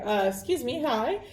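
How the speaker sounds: ordinary delivery, nothing marked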